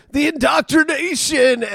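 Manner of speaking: high, slow, mocking pundit voice; mocking right-wing voice. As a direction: sneer it like an outraged critic. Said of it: "people on the right are like".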